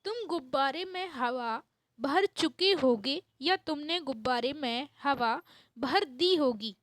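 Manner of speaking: ordinary delivery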